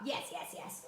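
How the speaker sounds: breathy whisper under her breath; whispered yes. Real, not acted. Teacher seeing viewers' thumbs, whispering yes. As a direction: whisper it to herself, pleased.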